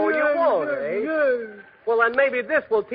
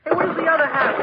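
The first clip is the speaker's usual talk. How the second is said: normal talking voice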